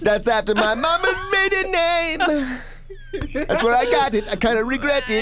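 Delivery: hammed-up sing-song Middle Eastern reggae voice; Middle Eastern reggae accent. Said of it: "It's your Middle Eastern reggae".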